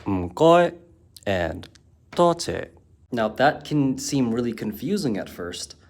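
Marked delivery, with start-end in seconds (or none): 0.0-0.7 "Mkoy" said high and loud with a Cantonese tone; Cantonese m goi. Shown as a demonstration. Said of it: "Cantonese has two forms of". 2.1-2.7 "toche" said high, falling in Cantonese tone. Shown as a demonstration. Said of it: "Cantonese has two forms of".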